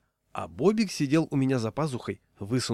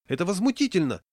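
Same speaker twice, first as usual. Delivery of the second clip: much higher squeal of outrage; kreischend, viel höher; 8 semitones higher, 4 dB louder than usual. A narrator's reading. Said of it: "взвизгнул".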